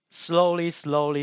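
ordinary delivery

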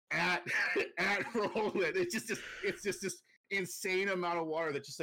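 can't stop laughing while describing it. Real, not acted. Telling a friend about the shark wave, laughing.

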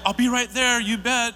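high, loud, eager "I'll be right there"; cheerful janitor. Retold or imitated. Janitor Jim answering a cleanup call, bright and eager. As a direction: chirp it like a happy helper. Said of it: "Jim is always like".

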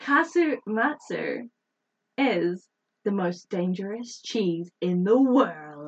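slow, weighty announcement; slow dramatic reveal. Acted. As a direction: build suspense word by word. Dramatic reveal of deadly food.

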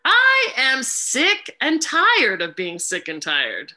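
high, loud and very slow, every word stretched; loud drawn-out mantra. Shown as a demonstration. Facilitator showing a new inflection, loud and drawn out. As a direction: belt it out slowly like a protest chant. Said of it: "say it in different inflections".

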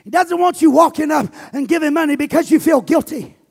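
loud, driving preacher cadence; preaching at full force. Acted. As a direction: pastor preaching to his congregation on giving, full force. Preaches about giving at full force.

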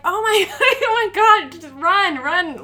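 high, loud panicked cries mixed with laughter; panicked screaming. Retold or imitated. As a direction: shriek it like you're running for your life. Fleeing a masturbating man, screaming.

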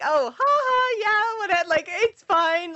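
high, airy laugh-it-off voice, slowing at the end; mock breezy brush-off. Retelling her own shrug to friends, a high mock-casual brush-off. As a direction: shrug it all off with a fake laugh.

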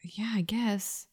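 soft unvoiced whisper. Real, not acted. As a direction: whisper it in the dark tomb. Whispering in tomb.

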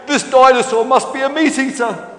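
high, loud dinosaur alarm; panicked shout. Acted. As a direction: shout as you turn to flee. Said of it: "shouted Stefan".

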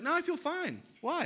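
a light mimic of the unbothered wife; imitating wife. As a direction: toss it off like it's nothing. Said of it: "She's like".

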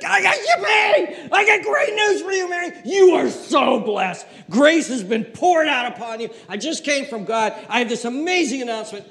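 a giddy Gabriel bursting with news; overjoyed angel. Acted. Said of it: "Gabriel is so excited".

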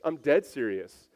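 usual speaking voice

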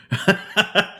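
high burst of laughter at his own joke; real laugh. Real, not acted. Podcaster laughing at his own Tinder joke, high laugh. Joking about being on Tinder.

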